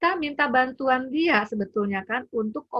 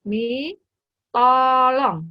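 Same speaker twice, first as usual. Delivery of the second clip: put-on child asking nicely; 3 semitones higher, 7 dB louder, much slower than usual. Child taught to say please to mum, loud pleading. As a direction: beg mum like a little child. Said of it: "bilangnya gimana?".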